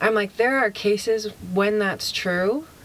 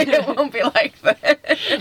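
laughing; 7 semitones higher, 6 dB louder, slower than usual. Real, not acted. Laughing aside.

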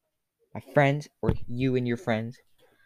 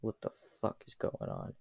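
softer whispered confusion; confused whisper; 3 semitones lower, 13 dB quieter than usual. Acted. Hero whispers in confusion as guards bow. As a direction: hiss it under your breath in disbelief. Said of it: "You're like".

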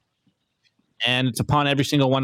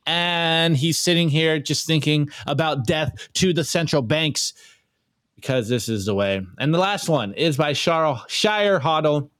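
usual speaking voice

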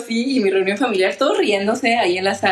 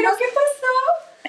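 nosy aunt; slower than usual. Retold or imitated.

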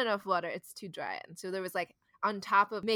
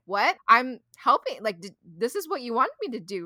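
loud, raised retold objection; baffled protest; 3 semitones higher, 7 dB louder than usual. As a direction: protest your innocence, replaying the argument. Retold bewildered protest to mom.

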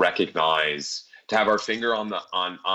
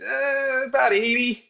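higher, sheepish little admission; slower than usual. Retold or imitated. Driver confessing his speed to the cop, sheepish. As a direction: own up like a caught kid.

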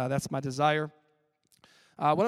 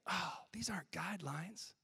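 hushed revelation, soft and breathless; whispered realization; 14 dB quieter than usual. Retold or imitated. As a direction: whisper it like a discovery. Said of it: "I was like".

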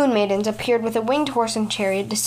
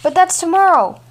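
higher and louder in alarm; dismayed cry; 7 semitones higher, 7 dB louder than usual. A narrator's reading. Parent realizing the daughter leaves tomorrow, higher louder alarm.

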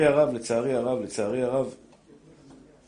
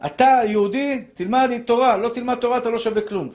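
stern rebuke; 6 semitones higher, 4 dB louder than usual. Real, not acted. Preacher scolding the congregation.

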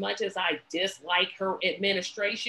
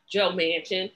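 ordinary talk, nothing marked

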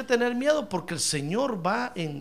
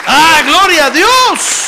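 slow, much higher and much louder cry of praise; ecstatic praise; 9 semitones higher, 16 dB louder, much slower than usual. Real, not acted. Preacher praising God before his congregation, an ecstatic loud cry. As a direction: cry it out to heaven.

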